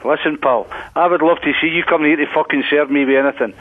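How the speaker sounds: usual speaking voice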